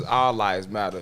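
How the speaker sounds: slow, low mock of the slogan. Mocking the posters' slogan, slow and low. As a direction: drag out a sarcastic all lives matter. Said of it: "Y'all got your posters".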